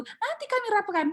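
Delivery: exasperated, comic high-pitched parroting; mocking repeated brush-off. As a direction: parody an endlessly stalling official. Mocks the endlessly repeated reply.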